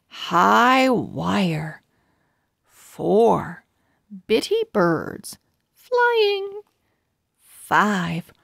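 bright storybook reading with lively pauses; reading to children. A narrator's reading. Host reading a chapter to young viewers, storybook narration. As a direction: read it like a picture book for little listeners.

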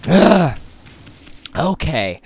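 worn-out hoarse voice. Real, not acted. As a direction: speak with a shot, hoarse voice. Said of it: "my voice is shot".

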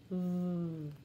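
V as a long low buzz "vvv"; letter sound. Shown as a demonstration. Teacher drilling letter sounds, V as "vvv". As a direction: buzz V with no vowel.

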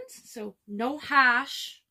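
put-on "Harsh" said slowly as "hash" with no r, a friend's accent; imitating partner's accent. Copying her collab partner's accent for viewers, playful accent. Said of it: "I just love her accent".

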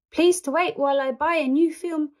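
Japanese lady. Acted. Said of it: "said a Japanese lady".

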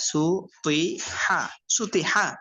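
সু, তুই, হা with pauses, then সুতি হা slowly; broken pronunciation. Shown as a demonstration. Teacher models broken pronunciation for students, slowly. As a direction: break it into syllables, slowly. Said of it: "ভাঙ্গা উচ্চারণ করবেন একটা একটা".

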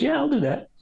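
lightly voiced cheerful woman; quoting the designer. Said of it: "she goes".

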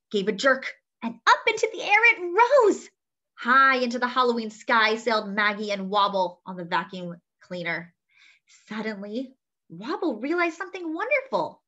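usual speaking voice

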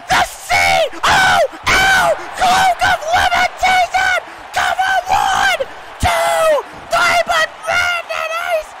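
very high, hysterical shouting; frantic screamed play-by-play. Real, not acted. Commentator sees the referee miss the pin, screaming frantically. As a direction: scream through the near fall. Near pinfall, referee distracted.

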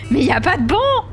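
loud, high complaint about the missing benches; mock outcry. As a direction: play it as an outraged traveller shouting. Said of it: "Gare du Nord, be like".

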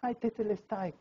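weak, very soft mock moan; as if dying. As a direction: imitate a dying man's faint sigh. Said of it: "como si estuviera muriéndose".

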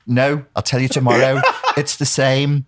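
mock Scouse insistence. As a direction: play the Scouser, half laughing. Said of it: "And I go".

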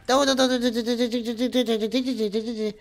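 rapid-fire stuttered no's, high pitch; panicked. Real, not acted. Streamer in trouble in the game, panicked stutter. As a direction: rattle it out in a rising panic.

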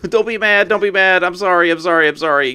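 sheepish laughing sorry; laughing apology. Real, not acted. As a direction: beg forgiveness through laughter. Laughing apology after mishap.

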